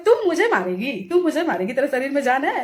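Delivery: high, mocking retort; scornful challenge. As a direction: throw the threat back in disbelief. Retold comeback to a threat.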